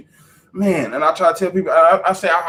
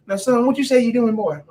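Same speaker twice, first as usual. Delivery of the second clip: high mock-stern mom voice, louder; imitating his mom; 10 semitones higher, 3 dB louder than usual. Son retelling his mom questioning him, mock-stern high. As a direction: play the scolding mother, pitched up. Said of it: "'cause she was like".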